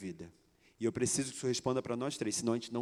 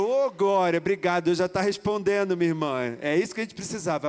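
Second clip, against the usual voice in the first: loud, raised exclamation of praise; retold jubilation; 5 semitones higher, 8 dB louder than usual. Man praising God for the answer through his praying sister, jubilant. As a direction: cry it out in triumphant thanks.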